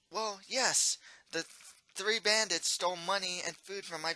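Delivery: weary baker thanking the prince; baker woman. Acted. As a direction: voice the upset baker, resigned. Distressed baker woman voiced.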